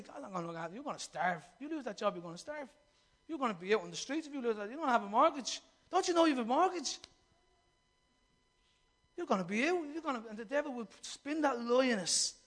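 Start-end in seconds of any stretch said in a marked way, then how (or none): 0.0-10.2 enemy's lie. Retold or imitated. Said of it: "our enemy will put in and say".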